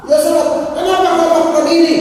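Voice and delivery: acted angry scolding. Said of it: "Misalnya kalau saya marah kepada Yosef".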